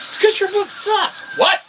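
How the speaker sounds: high falsetto mom heckle; shrill-free high mom voice butting in; mock mom voice. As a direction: squeak it out like a teasing mother. Mocking Mom voice interjecting.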